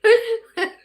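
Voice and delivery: short loud squeal of a laugh; high squealing laugh. Real, not acted. Laughing loudly at her own joke to the viewer.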